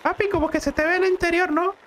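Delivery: put-on high, fast whiny kid voice; son voice. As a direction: play the complaining little boy.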